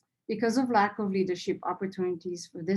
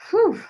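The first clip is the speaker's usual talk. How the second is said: sharp high blown-out whew, louder; relieved whew; 13 semitones higher, 9 dB louder than usual. Real, not acted. Speaker at the AGM, releasing nerves with a loud whew. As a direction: let out the tension in one loud breath. Exhale after emotional moment.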